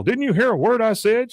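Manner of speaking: exasperated. Retold or imitated. Hank scolds Luann.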